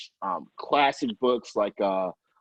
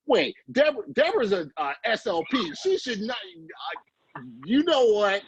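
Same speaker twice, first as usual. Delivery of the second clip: playful alarm; 9 semitones higher than usual. Real, not acted. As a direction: pitch jumps far up in joking panic. Joking panic over criticizing Disney.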